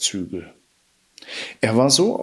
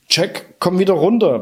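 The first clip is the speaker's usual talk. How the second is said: a touch of a mother's call, higher; commanding; 8 semitones higher than usual. A narrator's reading. Widow ordering her son down the beanstalk, commanding. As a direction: call him down firmly, mildly raised. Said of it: "befahl die Witwe".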